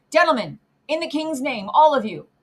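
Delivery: rally them with a commanding call; urgent summons. A narrator's reading. Guard to passengers in the king's name, calling out.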